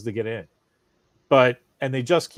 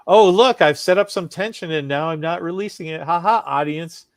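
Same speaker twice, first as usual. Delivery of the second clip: gloating mockery; 4 semitones higher, 7 dB louder than usual. Mocking a smug screenwriter.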